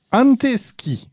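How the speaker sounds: French-accented Finnish. Shown as a demonstration. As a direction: read "anteski" with a French accent, pitched high. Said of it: "je vais prononcer avec mon accent français".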